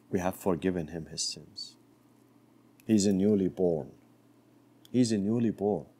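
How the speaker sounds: low, soft and gentle divine voice; voice of God. Acted. God telling Moses to reassure the young man, low and gentle. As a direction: speak as a tender, forgiving God.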